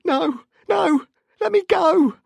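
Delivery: loud, very high panicked screams; pleading. A narrator's reading. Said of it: "he pleaded".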